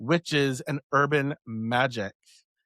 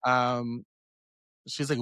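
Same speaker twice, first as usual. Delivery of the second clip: normal talking voice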